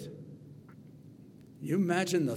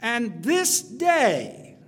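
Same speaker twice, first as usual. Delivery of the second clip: slow, loud, higher and weighty; emphatic; 6 semitones higher, 6 dB louder, much slower than usual. Acted. Preacher reading David's vow, slow and loud. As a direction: lean hard on this day.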